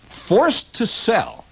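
high, drawn-out proclamation; dramatic sign reading. Acted. Reading a shop window sign aloud, slow and high with mock drama.

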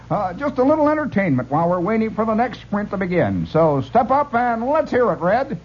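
high, showy emcee announcement; dance-hall emcee. Retold or imitated. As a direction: introduce the act like a dance-hall host. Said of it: "a guy would come out and he'd say".

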